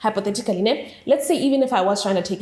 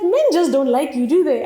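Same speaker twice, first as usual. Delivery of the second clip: louder, higher mocking jab; joking tease; 6 semitones higher, 6 dB louder than usual. Friend joking that men don't like her, loud teasing.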